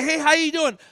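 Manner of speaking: high, loud casual greeting; cheery greeter. Acted. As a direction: play a hearty acquaintance saying hello. Acted greeting to busy person.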